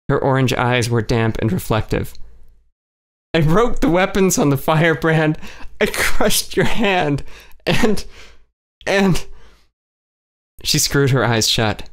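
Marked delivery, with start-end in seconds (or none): 3.3-9.3 higher, slow, tearful stammer; sobbing confession trailing off; crying, breaking off. A narrator's reading. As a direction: let the words break up in tears. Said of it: "She kept crying".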